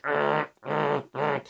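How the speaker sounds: short growled bursts like broken machinery; imitated grinding noise. Vlogger mimics his computer's graphics card fan, imitated grinding. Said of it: "like, grinding, going like".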